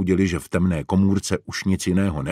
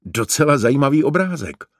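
high, louder burst of keen interest; enthusiastic; 7 semitones higher, 5 dB louder than usual. A narrator's reading. Franta shows his find on the wall, excited. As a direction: exclaim it, thrilled by the find. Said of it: "vykřikl nadšeně".

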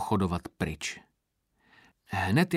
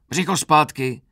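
raised, loud drill correction; shouted order; 6 semitones higher, 8 dB louder than usual. A narrator's reading. Sergeant correcting recruit's stance, shouted order. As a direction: snap the order out loudly.

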